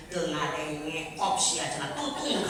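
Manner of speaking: put-on old woman, exasperated. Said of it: "cek si ibu urang maja".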